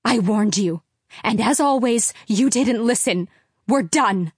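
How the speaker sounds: loud, final, furious; angry mother. Acted. Mother angrily telling husband they are done. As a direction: slam the door on him with words. Mother ending marriage in argument.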